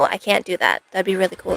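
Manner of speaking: ordinary delivery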